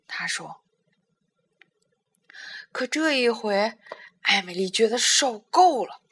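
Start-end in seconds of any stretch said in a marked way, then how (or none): none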